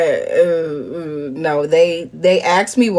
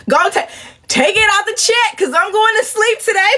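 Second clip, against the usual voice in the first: defiant squeal; 13 semitones higher than usual. Retold or imitated. Defiant rant about sleeping.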